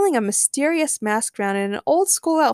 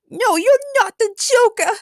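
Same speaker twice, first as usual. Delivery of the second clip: high, slow, loud Harley protest; shouted; 14 semitones higher, 7 dB louder than usual. A narrator's reading. Harley rejects the false Joker, shouting. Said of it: "Harley shouted at Phantom Thief Joker".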